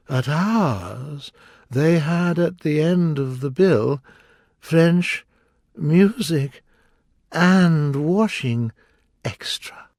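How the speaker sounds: high, slow, plaintive Mock Turtle; drawn-out mournful turtle voice. A narrator's reading. Mock Turtle boasting to Alice of his school, high and slow.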